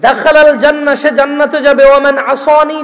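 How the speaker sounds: loud, measured sermon recitation with chanted Arabic; solemn preacher reciting scripture to the crowd; preaching a hadith. Acted. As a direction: intone the Arabic slowly, then preach the meaning.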